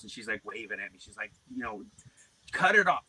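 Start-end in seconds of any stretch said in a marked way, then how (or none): 2.5-3.0 mildly raised, urging quote; imitated urging wife. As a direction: lightly mimic a wife calling across, higher and louder.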